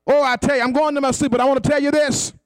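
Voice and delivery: ordinary talk, nothing marked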